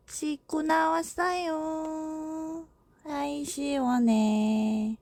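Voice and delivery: slow, soft, lightly voiced baby; voicing the baby. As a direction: drawl it like a relaxed infant. Voicing the baby after a bath. (아기 목소리 흉내)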